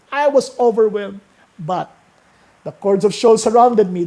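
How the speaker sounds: loud, high, rising scripture reading; preaching at full force. Acted. Preacher pressing the psalm on the congregation, full-force preaching.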